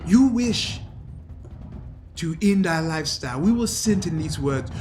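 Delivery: put-on battle-hardened older man, mildly angry; accented voice of an aggrieved old fighter; pissed Wakandan veteran. Captured Wakandan protesting being cast aside, indignant character voice.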